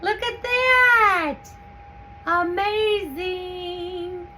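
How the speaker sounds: high, slow, drawn-out delight; thrilled. Real, not acted. Canning peppers, cheering as the jars fit. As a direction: stretch each word out in awe.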